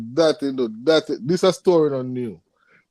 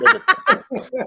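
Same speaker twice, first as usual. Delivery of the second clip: high burst of laughter. Real, not acted. Panel laughing at Doctor Phil.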